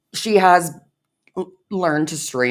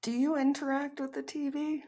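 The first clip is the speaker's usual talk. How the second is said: much higher and softer, teasing; squeaky put-on voice; 14 semitones higher, 9 dB quieter than usual. Co-host teasing the streamer, squeaky voice. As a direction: ask it in a silly tiny voice.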